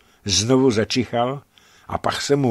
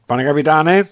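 raised call to the captain; 5 semitones higher, 7 dB louder than usual. A narrator's reading. Lockhart calls the captain on the bridge, raised call. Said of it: "ozval se Lockhart".

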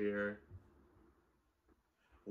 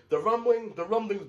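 excited; 10 semitones higher, 8 dB louder than usual. Real, not acted. Summing up chapter events.

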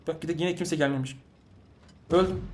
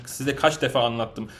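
usual speaking voice

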